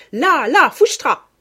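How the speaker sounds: loud high Auvergnat shout; shouted cry. A narrator's reading. Mother shows child what to yell, loud shout.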